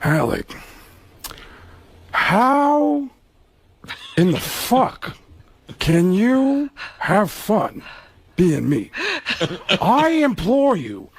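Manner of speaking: slow, drawn-out anguished Pacino; slow, high-strung Al Pacino lament; Pacino impression. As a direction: stretch every word, pleading and bitter. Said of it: "Pacino looked at him and said".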